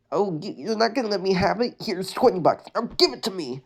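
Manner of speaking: high, entitled Karen demand; mock entitled Karen. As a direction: play the pushy Karen customer. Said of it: "go full Karen on it".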